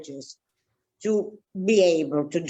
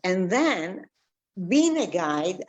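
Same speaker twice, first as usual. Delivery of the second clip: normal talking voice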